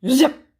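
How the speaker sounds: sharp, loud zip of a flying arrow. A narrator's reading. Arrow flies off with hat.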